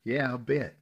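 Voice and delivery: high, loud bitter scoff. Acted. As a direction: scoff at his apology. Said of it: "she answered bitterly".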